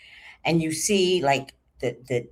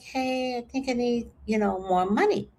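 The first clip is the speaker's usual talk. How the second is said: higher, soft and hesitant; careful, higher-pitched plea; tentative request; 7 semitones higher, 4 dB quieter than usual. Retold or imitated. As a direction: ease into asking for a favor. Timidly asking ex for money.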